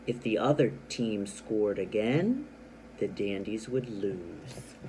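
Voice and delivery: AGAIN rising and held, pause, then slow low LOSE; pause at comma. Shown as a demonstration. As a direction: hang on AGAIN, then let LOSE sink slowly. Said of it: "I took a pause after the comma".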